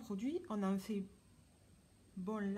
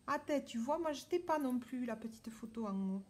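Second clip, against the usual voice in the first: normal talking voice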